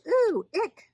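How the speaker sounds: high, loud squeal of revulsion; squeamish disgust. A narrator's reading. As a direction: recoil at touching a snake. Moses recoils from snake's tail.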